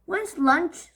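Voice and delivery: high, loud demanding yell; scream. Acted. Henry screaming for his lunch. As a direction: demand it at the top of your voice. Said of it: "screamed Horrid Henry".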